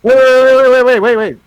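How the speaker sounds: loud, high burst of waits; urgent interruption. Real, not acted. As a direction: cut in loudly and high to halt everything. Cuts in loudly to demand a voice.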